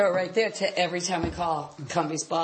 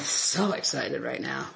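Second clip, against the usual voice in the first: low, flat, mock-excited; deadpan excited; 8 semitones lower, 5 dB quieter than usual. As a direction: say you're thrilled with zero energy. Deadpan joke about being excited.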